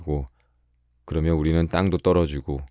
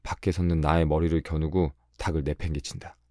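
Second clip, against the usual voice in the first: usual speaking voice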